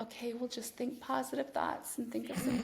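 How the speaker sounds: softly voiced positive-thinking coach, parodied; mock gentle coaching. Parodying the industry's positive-thinking advice, mock gentle.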